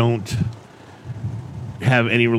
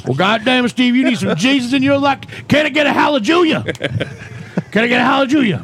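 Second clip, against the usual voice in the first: high, fervent revival preacher; 7 semitones higher than usual. Acted. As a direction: whip up the congregation. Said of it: "this new character called Pastor Pat".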